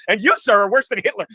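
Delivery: loud, emphatic mock condemnation; mock indignant. Woman turns on Homer in retold Simpsons scene, mock outrage. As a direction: deliver a cartoon put-down, hitting the opening hard. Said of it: "looks at him and goes".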